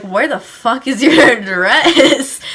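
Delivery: loud, drawn-out mock exasperation breaking into laughter; exasperated demand. Cop hunting for the house, mock exasperated. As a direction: imitate a fed-up cop, stretching the words.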